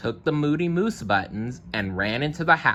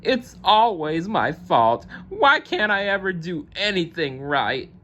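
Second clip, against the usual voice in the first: wailing; 10 semitones higher than usual. Acted. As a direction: drag it out, crying in self-pity. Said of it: "wailed Moose".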